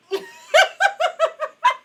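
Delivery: a high burst of squealing laughter. Real, not acted. Speaker recalling her joke, squealing laugh.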